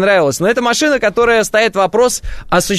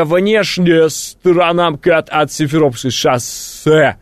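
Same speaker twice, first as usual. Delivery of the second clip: slow, drawn-out put-on traffic-report voice; someone else's voice; slower than usual. Host reads traffic to listeners, put-on drawling voice. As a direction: stretch out the words in a borrowed voice. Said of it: "это не мой голос".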